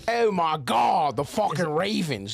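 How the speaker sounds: drawn-out Baltimore accent with exaggerated O's; hammed-up Baltimore Ravens fan accent. Shown as a demonstration. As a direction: stretch the vowels in a broad Baltimore accent.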